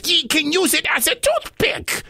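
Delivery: high, insistent pirate plea; pleading pirate. Acted. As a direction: beg the giant while coaxing him.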